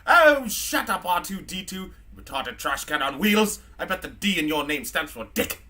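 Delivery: put-on scornful drunken British robot; drunk C-3PO. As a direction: snap at a sidekick as a mean drunk British droid. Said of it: "three P O's just a mean fucking drunk".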